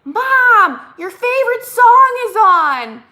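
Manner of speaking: high, loud call to mom; imitated yell. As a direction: holler like a kid from another room. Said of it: "she's yelling to me".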